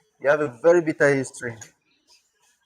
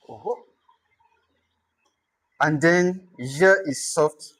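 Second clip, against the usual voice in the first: ordinary talk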